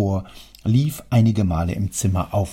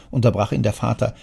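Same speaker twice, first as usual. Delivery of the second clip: plain reading voice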